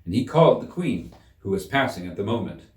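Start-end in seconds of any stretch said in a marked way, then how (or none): none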